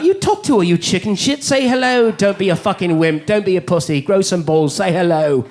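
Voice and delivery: goading life coach in a put-on English accent; bully into action in an English accent; English-accented mind. Mind egging him on to say hello, put-on English accent. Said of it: "my mind would be like".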